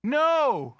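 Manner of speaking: loud, high, drawn-out yell; yelled protest. Retold or imitated. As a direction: re-enact a football fan's outraged shout. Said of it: "and we yelled".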